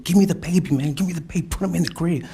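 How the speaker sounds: high, loud reenacted plea; urgent demand. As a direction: raise the voice, pleading urgently. Retold urgent demand for baby.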